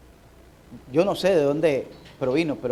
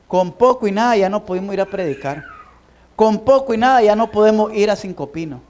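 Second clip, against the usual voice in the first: loud, higher rebuking sermon voice; fiery preaching; 6 semitones higher, 8 dB louder than usual. Acted. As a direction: scold the congregation with mounting fervor. Preaching at full force. (predicación enérgica y fuerte, regañando a la congregación)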